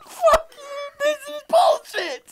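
high, slow squeaky rant. Pretend. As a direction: squeak it out slowly. Mock frustrated game voice.

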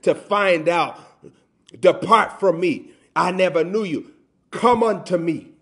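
loud, slow, emphatic sermon delivery; forceful preaching. Acted. Preacher urging people not to wait for judgment, forceful. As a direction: drive each phrase home from the pulpit.

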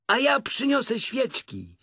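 high-pitched eager storybook cry; shouting. A narrator's reading. Friends offering to bring candles, high eager shout. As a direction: call it out high and keen. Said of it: "krzyknęli wszyscy chórem".

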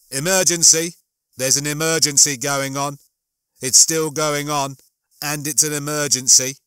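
loud, insistent alarm announcement; urgent ship's computer. Acted. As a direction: sound the alarm in a loud, even voice.

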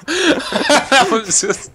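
laughing. Real, not acted. Laughing at beetle.

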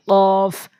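"কফ" held long on a level tone; model letter recitation. Shown as a demonstration. Teacher has learners repeat along, long level "কফ". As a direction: draw out "কফ" for learners to follow. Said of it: "আমার সাথে উচ্চারণ করতে থাকুন".

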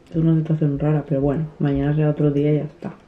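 ordinary talk

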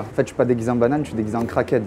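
hushed voice from a hiding spot; whispering while hiding. Real, not acted. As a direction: keep it low so the seeker can't hear. Talking quietly while hiding.

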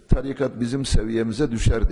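usual speaking voice

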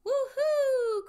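put-on loud, high child's whoop; joyful cry. A narrator's reading. Pip-Pip soars out of the water, joyful cry. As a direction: whoop like a thrilled little one. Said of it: "cried little Pip-Pip".

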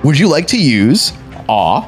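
ordinary talk

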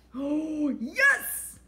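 high delighted yes; a gasp and a high gleeful yes; thrilled gasp. Acted. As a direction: gasp then cheer. Delighted at his dare.

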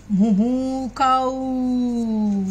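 very slow, stretched moo on a held note; drawn-out mooing. Pretend. Teacher pasting patches for the cow puppet, drawn-out mooing.

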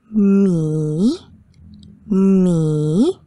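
Ma, Ge, Mi, Wu slowly dipped and swung back up; dipping third tone. Shown as a demonstration. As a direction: draw out Ma, Ge, Mi, Wu, dropping low, then rising. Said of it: "The tone drops and rises".

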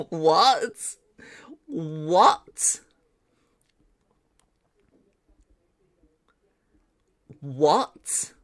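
loud, drawn-out rising what; stunned disbelief. Real, not acted. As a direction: blurt it out, utterly amazed. Amazed at the render result.

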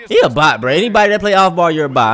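loud, heated call-out; fired-up gamer trash talk; angry rant. Real, not acted. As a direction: shout him down as a bot. Ranting at an off-ball opponent.

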